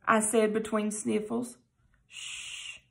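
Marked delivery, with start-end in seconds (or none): 2.1-2.8 unvoiced hush; soft shush. A narrator's reading. Mother soothing crying son with a soft shush. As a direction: hush him gently. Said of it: "she said".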